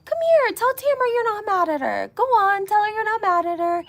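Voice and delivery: fiancé urging Teresa to make peace, in a high coaxing mock voice; quoting Louis, high and pushy. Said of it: "And then he's like".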